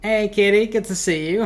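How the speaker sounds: high, loud, cheery hello; bright shout to a familiar viewer; happy greeting. Real, not acted. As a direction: greet a friend in chat with delight. Streamer greets a chatter.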